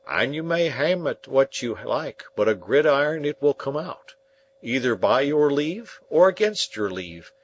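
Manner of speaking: a hearty, homely smith; Joe the blacksmith. Acted. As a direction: speak as plain, plodding Joe, weighing every word.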